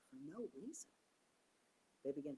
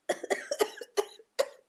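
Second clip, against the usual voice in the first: coughing laugh; 19 dB louder than usual. Real, not acted. Coughing laugh mid-story.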